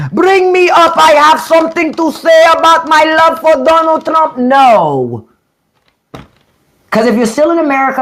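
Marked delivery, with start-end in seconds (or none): 0.1-1.0 mock foreign viewer. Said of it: "It was another Indian". 1.0-4.4 mock foreign viewer. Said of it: "It was another Indian".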